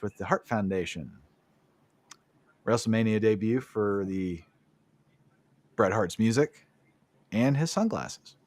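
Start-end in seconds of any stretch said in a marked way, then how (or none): none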